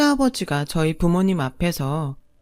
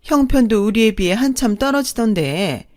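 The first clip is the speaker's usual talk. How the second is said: higher, louder swooping sneer; haughty second mother-in-law, scornful; 4 semitones higher, 4 dB louder than usual. Acted. Second mother belittling the bride's parents, haughty and scornful. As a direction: look down on them with sing-song disdain.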